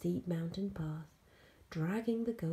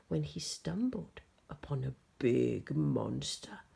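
plain reading voice

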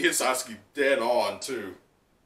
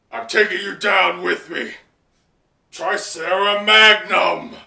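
loud, higher shout, the attack name drawn out slowly; 6 semitones higher, 9 dB louder, slower than usual. Retold or imitated. Sasuke takes Frankie down, shouting his attack name. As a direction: call out the final attack. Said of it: "Sasuke goes".

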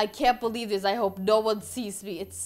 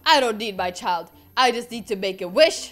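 usual speaking voice

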